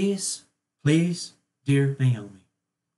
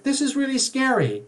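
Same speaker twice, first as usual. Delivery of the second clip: a much higher, lightly scared imitation; mock fright; 9 semitones higher than usual. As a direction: squeak it out like a frightened client. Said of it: "It's like".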